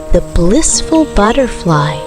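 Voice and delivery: plain reading voice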